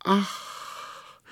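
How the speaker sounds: high breathy ach of delight; delighted little breath; joyful gasp. A narrator's reading. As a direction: gasp with glee. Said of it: "rief sie freudig".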